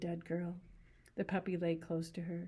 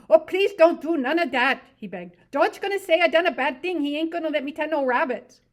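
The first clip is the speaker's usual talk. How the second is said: begging; 10 semitones higher, 14 dB louder than usual. Acted. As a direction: beg her to stop.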